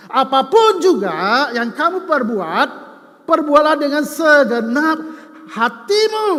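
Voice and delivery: slow and loud, hatimu lifted high; emphatic scripture reading. Real, not acted. Pastor reading Colossians to the church, slow loud proclamation. Reads Colossians verse with force.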